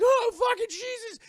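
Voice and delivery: high, loud mock scream; imitating a panicked woman screaming; hammed-up freak-out. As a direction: shriek it in exaggerated shock. Acting her freak-out after the fight.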